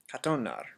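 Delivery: katonar, A dropped and run into the O; katonar, blending kata into onar; blended katonar. Shown as a demonstration. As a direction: katonar said as one word with no A. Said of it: "katonar instead of kata onar".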